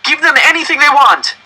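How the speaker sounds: loud, high desperate yell; boy yelling at his family, loud. Acted. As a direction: shout at the adults to hand it over. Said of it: "I yelled".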